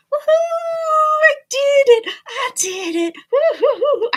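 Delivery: high, loud victory whoop; cheering the finished task; triumphant cheer. Real, not acted. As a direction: celebrate the win out loud. Triumph after the struggle.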